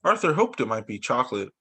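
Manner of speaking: usual speaking voice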